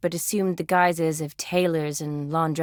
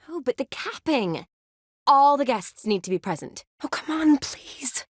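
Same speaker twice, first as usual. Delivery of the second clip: coaxing protest; 6 semitones higher than usual. Acted. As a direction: coax them, ending on a hushed please. Coaxing Geralt to stay for capping.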